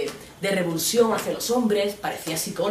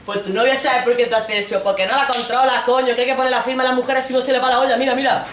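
colegas machistas, fuertes y altos; macho buddies; 6 semitones higher, 8 dB louder than usual. Retold or imitated. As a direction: aconseja con chulería. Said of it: "Y los tíos". (los tíos dando lecciones de control)

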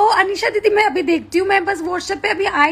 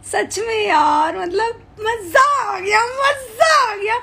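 slow, rising to a much higher squeal; gleeful gush; 5 semitones higher, slower than usual. Real, not acted. Streamer thanking fans live, rising gleeful squeal. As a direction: build the joy up into a squeal. Gushing about the love.

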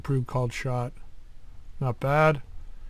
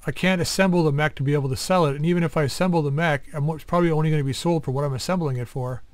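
ordinary talk

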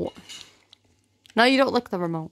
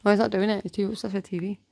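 ordinary talk

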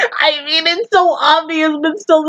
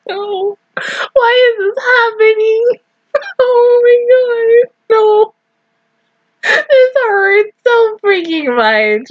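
high, tearful wail, dragged out slow; real heartbreak at the screen, near tears; real tearful whine; 10 semitones higher, slower than usual. Real, not acted. Fan upset that friends must fight, tearful high whine.

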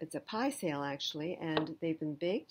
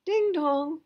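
high, loud sung doorbell chime; doorbell ding dong; 11 semitones higher, 10 dB louder than usual. Acted. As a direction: ring it out like a doorbell. Imitated doorbell in story.

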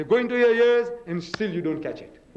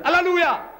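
high, loud mock cry of praise; crowd exclaiming; 12 semitones higher, 5 dB louder than usual. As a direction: burst out like an excited congregation. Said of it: "so everybody exclaims".

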